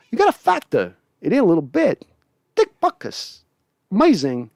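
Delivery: clipped Walken comparison of Butkus and Wilson; Walken impression. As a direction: list player traits in a halting Walken cadence. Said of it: "Usually I got a good Walken".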